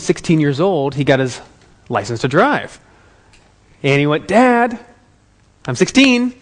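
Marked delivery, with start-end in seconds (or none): 4.3-6.3 higher-pitched, eager imitation of a teen; eager teenage son. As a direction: play a kid proudly announcing himself to Dad. Teenage son announces himself to dad.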